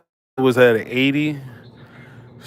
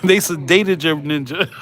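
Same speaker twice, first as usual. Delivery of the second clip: laughing; 4 semitones higher than usual. Real, not acted. Laughing at own sedation story.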